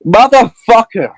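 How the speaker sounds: slow, high-pitched enraged curse; furious yell. Acted. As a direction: yell it drawn out as you kick. Character screams and kicks the wall.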